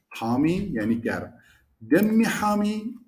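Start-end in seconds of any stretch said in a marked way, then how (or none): 1.9-3.0 دمی said with a long doubled m, higher and slow. Shown as a demonstration.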